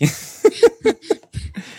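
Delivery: high bubbling laugh; giggle. Real, not acted. Laughing at the forgotten goodbye, high giggle.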